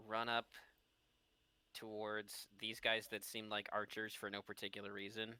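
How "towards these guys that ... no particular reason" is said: normal talking voice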